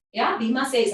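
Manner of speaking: plain reading voice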